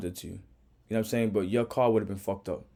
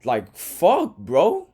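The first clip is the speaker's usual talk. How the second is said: furious rant; 7 semitones higher, 4 dB louder than usual. Real, not acted. Reaction rant, yelling at driver.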